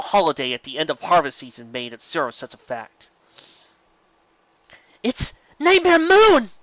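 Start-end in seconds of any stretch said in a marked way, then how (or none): 5.0-6.5 slow, much higher and louder alarmed cry. A narrator's reading. Ken warns the others, slow high alarmed cry. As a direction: cry out the name in shock. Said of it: "Ken hissed".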